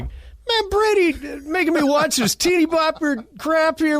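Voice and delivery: high whiny mock scoff at a teen show; mocking skeptic. Husband scoffing at the show his friend recommended, high mocking whine. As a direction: sneer at the teeny bopper show in a squeaky voice. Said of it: "I was like".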